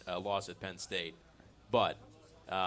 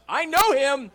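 thrilled, much higher and louder; excited shout; 12 dB louder than usual. Real, not acted. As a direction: shout with sudden delight. Spots Santa arriving at mic.

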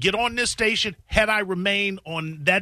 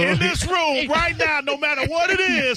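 laughing shout; 9 semitones higher than usual. Real, not acted. Laughing loud joke to room.

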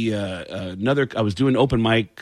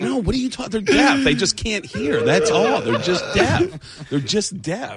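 much higher, laughing exasperated protest; laughing disbelief; 8 semitones higher than usual. Retold or imitated. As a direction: plead through laughter that they're just deaf. Explaining deafness, laughing.

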